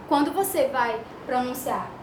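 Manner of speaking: normal talking voice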